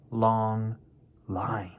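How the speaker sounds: ordinary delivery, nothing marked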